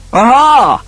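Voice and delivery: high, loud, triumphant aha; gloating. A narrator's reading. Eldest brother catching out the Marquis, gloating. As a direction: pounce on his secret with glee.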